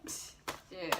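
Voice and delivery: ordinary talk, nothing marked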